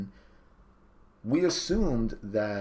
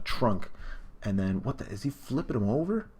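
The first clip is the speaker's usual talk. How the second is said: ordinary talk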